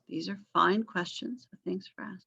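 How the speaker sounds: ordinary talk, nothing marked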